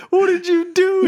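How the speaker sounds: high, slow, laughing panic; panicked brother. Retold or imitated. Retelling his brother's panic, high and laughing. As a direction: squeal it out in shock, half laughing.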